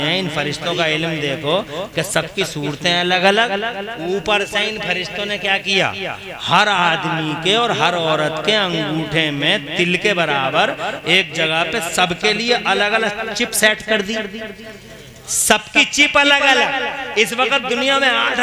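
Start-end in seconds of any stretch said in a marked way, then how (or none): none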